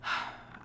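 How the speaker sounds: breathy demonstration sigh of exhaustion; weary sigh. Shown as a demonstration. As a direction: let out a tired sigh. Acts out being exhausted.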